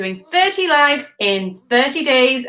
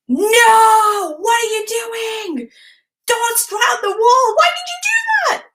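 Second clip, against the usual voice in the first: retold yell; 12 semitones higher than usual. Mother yelling at son writing on wall. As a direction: shriek it like catching him mid-mess. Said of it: "And I went".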